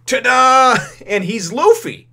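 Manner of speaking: laughing reveal, high and loud. Real, not acted.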